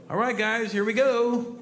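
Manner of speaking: high-pitched call to the group; rally the guys before landing; acted crewman calling out; crewman's heads-up. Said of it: "the guy's gonna get up right before we land and go".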